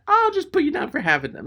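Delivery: high, quick mimicry of a clerk; pushy clerk. Rental clerk pressing a customer to buy gas, pushy. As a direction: talk right over the customer in a high voice.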